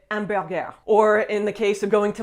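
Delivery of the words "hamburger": "hamburger" the French way, no H, ending "-gair"; French accent. Shown as a demonstration. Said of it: "You're gonna have to say".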